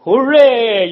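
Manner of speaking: loud mock cheer of a happy crowd. Union workers cheering their rep's news, loud cheer. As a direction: shout it like a whole crowd celebrating. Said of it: "The crowd shouted".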